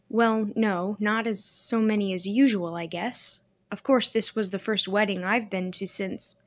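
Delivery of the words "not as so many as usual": usual speaking voice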